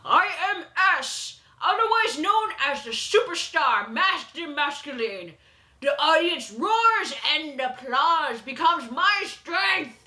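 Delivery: slow, showy self-introduction; lightly hammed-up wrestler boast; grandstanding villain. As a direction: announce yourself like a ring superstar.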